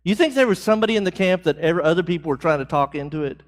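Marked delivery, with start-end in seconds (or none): none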